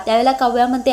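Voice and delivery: ordinary delivery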